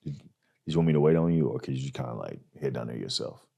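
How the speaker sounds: low, soft, hesitant mumble; sheepish limo driver. Retold or imitated. Limo driver sheepishly telling the comic to walk, low and soft. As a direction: awkwardly dodge the drive home. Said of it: "He comes up to me and goes".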